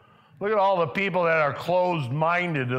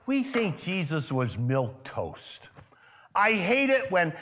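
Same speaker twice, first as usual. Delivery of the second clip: ordinary delivery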